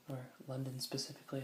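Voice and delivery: ordinary talk, nothing marked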